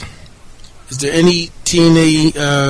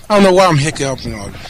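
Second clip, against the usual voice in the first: ordinary talk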